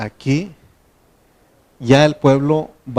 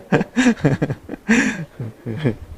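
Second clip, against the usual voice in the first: bursts of higher-pitched laughter; laughing; 3 semitones higher than usual. Real, not acted. Teacher amused by the class peeking at the Bible, laughing. Laughs after teasing remark to listeners.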